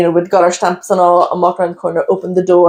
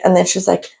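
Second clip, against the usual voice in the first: usual speaking voice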